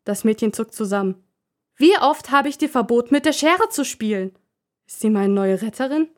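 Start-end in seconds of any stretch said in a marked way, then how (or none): 1.8-4.4 higher, louder scolding; mother scolding the girl for playing with scissors. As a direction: tell her off, exasperated.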